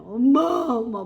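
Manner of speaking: mock wail for mom, drawn out; retelling her meditation tears, imitating a child sobbing for mom. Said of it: "막 그러면서 막 엉엉 우는 거예요".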